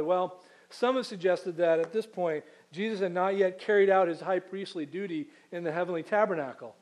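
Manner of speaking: ordinary talk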